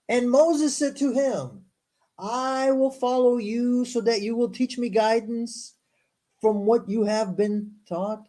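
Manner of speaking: slow, measured reading aloud; scripture reading. Acted. Host reading scripture to a stream audience, measured recital. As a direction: read it out formally, word by word.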